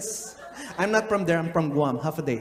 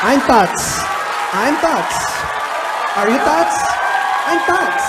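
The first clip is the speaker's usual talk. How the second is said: louder, higher shouted name; loud call; 5 semitones higher, 7 dB louder than usual. Acted. Shouting the name across the class, loud call. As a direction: bellow the name out.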